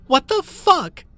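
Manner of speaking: shrill, loud scream; terrified shriek. Acted. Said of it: "Mia screamed".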